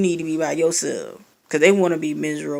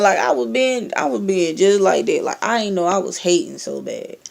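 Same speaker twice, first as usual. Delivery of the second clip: ordinary talk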